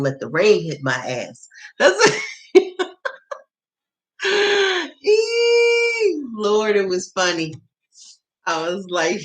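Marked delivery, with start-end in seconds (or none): 4.2-6.2 shrieking laugh cry, very high; high squealing laugh. Real, not acted. Laughing at her own story, high squeal. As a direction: let out a shrill giddy squeal.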